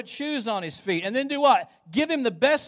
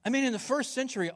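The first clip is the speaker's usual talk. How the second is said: normal talking voice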